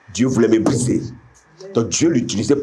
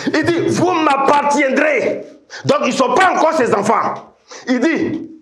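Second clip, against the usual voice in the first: high, ringing preacher's cry; full-force preaching. Real, not acted. Preacher declaring God's word to the assembly, full-force preaching. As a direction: proclaim it high to the whole assembly.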